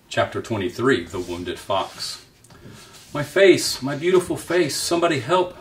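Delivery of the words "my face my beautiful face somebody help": high, loud cry for help; howling in pain. A narrator's reading. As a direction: wail it out in anguish. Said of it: "howling in pain".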